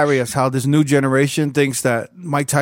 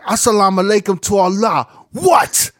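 imitating Tyson; 5 semitones higher than usual. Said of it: "he's like".

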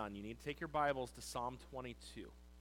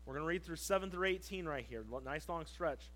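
ordinary delivery

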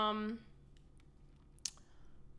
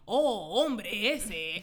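loud, hammed-up Spanish ad voice; mock Spanish accent; 6 dB louder, much slower than usual. Mocking the Cheez-It ad, loud mock Spanish accent. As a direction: parody the cheesy Spanish accent of a snack ad. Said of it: "had a Spanish accent".

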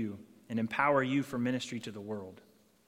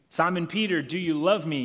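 higher and louder, urging an answer; pressing question; 5 semitones higher, 7 dB louder than usual. Acted. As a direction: press him hard, insistent. Jesus asks a second time.

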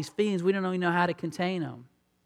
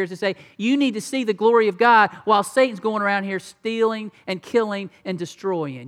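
ordinary talk

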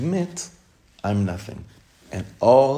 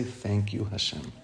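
much softer and lower, a hushed thank you; soft grateful thanks; 3 semitones lower, 8 dB quieter than usual. Acted. Rabbi modelling a prayer of thanks, soft and grateful. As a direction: let it fall quiet and grateful. Thanking God after seeing beauty.

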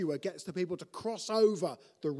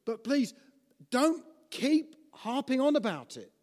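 pleading urge; 6 semitones higher, 5 dB louder than usual. Acted. Preacher urging congregation.

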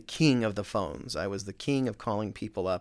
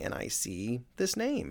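ordinary talk, nothing marked

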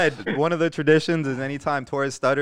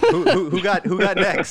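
raised mock call for next game; playful court call; 3 semitones higher, 3 dB louder than usual. Joking with friends about pickup games at the beach, playful call-out. Mimics calling out at handball court.

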